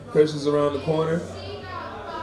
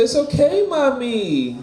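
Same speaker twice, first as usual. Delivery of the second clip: mock tender; 8 semitones higher, slower than usual. Comforting stripper, teasing punchline.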